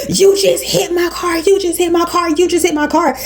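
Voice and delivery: high, insistent imitation of a man yelling; retold yelling. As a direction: mimic an angry driver yelling an accusation. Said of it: "he's yelling at me".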